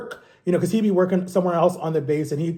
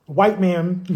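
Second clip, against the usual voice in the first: ordinary talk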